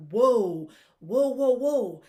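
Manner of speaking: loud, thrilled whoas; elated fan whoa. Retold or imitated. Fan reacting to the fumble, loudly elated. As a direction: play the fan cheering a fumble. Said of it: "you're like".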